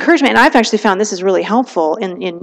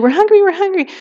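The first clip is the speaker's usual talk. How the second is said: put-on much higher whiny child voice; screaming kids; 10 semitones higher than usual. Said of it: "your kids are screaming at you".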